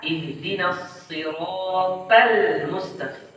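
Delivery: slow "siratal" with a thin, light t; wrong "siratal", emphatic t flattened to ordinary t; tha turned ta. Shown as a demonstration. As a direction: lighten the heavy t of "siratal" to plain ta. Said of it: "Mengubah huruf ta menjadi ta".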